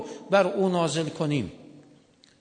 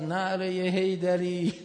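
usual speaking voice

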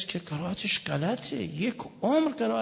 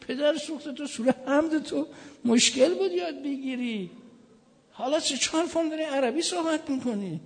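higher, lively mock indignation; mock outrage; 8 semitones higher than usual. Preacher teasing a shopkeeper who now speaks Arabic, higher mock outrage. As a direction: playfully scold the crafty shopkeeper.

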